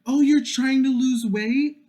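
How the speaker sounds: loud, very high mock amazement; gushing acquaintance. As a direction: squeal it like an overexcited friend. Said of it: "this is how the conversation would go".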